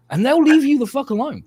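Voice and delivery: high loud punchline; excited. Real, not acted.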